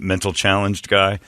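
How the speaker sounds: ordinary talk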